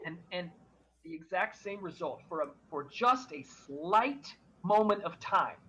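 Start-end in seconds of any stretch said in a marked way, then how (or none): none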